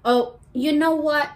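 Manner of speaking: ordinary talk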